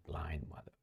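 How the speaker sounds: hushed, breathless curse under his breath; whispered curse. Real, not acted. Commentator searching his screen, cursing himself in a whisper. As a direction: swear at yourself in a whisper.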